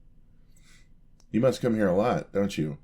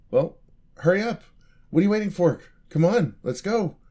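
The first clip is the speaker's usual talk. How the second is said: much higher and louder, bursting to go; eager urging; 10 semitones higher, 7 dB louder than usual. Acted. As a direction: hurry her along with excitement. Eager to set off.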